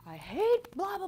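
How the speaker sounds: hammed-up bitter outburst, blah blah tossed off; mock angry rant. Mimicking her cynical self ranting at her lot, mock angry. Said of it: "super angry and cynical".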